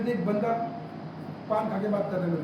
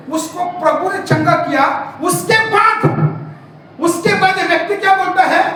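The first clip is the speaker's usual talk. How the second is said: loud, raised-pitch sermon storytelling; fiery pulpit retelling; forceful preaching; 7 semitones higher, 10 dB louder than usual. Acted. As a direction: preach the story at full force, voicing each character. Preaches the healing at full force.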